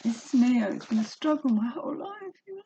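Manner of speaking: high-pitched, emotional confession near crying; close to tears. Real, not acted. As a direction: speak on the verge of real tears. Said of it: "Oh, I wanna cry".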